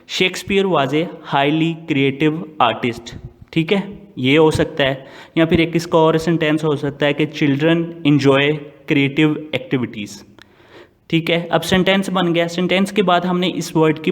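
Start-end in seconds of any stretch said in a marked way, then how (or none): 0.1-3.1 slow dictation. Shown as a demonstration. Dictating an example sentence. 7.2-10.1 very slow, word by word, as if dictating; slow dictation. Shown as a demonstration. Dictating an example sentence.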